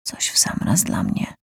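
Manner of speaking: whispered to himself. Acted. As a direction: breathe it out, unvoiced. Character thinks aloud, whispered.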